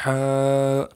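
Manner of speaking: ہا from the throat at everyday volume; normal-volume throat ha. Shown as a demonstration. Teacher showing pupils the moderate version, throaty ہا. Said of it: "بلکہ normal آواز ہو آپ کی".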